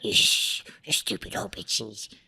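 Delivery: a cartoon villain's voice; cartoon character voice. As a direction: play a spiteful cartoon character. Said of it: "You're good at, uh, like, cartoon character voices".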